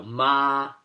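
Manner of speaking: MA held flat and level; first tone monotone. Shown as a demonstration. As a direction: sustain "ma" on one unchanging pitch. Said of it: "I'm speaking in a monotone".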